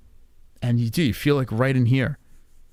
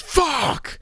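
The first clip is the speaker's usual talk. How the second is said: retold yell; 13 semitones higher than usual. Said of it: "And he just yells".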